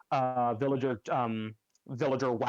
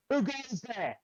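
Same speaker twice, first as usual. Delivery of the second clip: a guard calling out into the snow, a bit higher; guard challenge; 9 semitones higher than usual. Acted. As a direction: challenge them like a wary night guard. GM voices guard challenging party.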